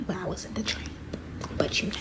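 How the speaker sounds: hushed, breathy aside to the audience; whispered aside. Real, not acted. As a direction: drop to a whisper for the joke. Whispered aside about Lady and the Tramp.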